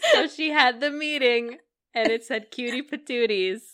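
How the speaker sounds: laughing through the words; giggling while telling it. Real, not acted. Laughing while telling funny story.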